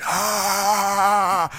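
high loud charging yell; kids screaming. Retold or imitated. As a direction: let out a kids' battle scream. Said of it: "they're screaming".

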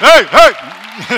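loud, high call to the crowd. Real, not acted. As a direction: call out to fire up the church. Pastor calls out to the crowd.

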